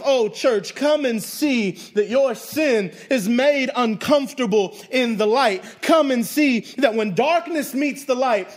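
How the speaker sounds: loud, high, impassioned sermon delivery; full-force preaching. Real, not acted. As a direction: preach it out to the whole church.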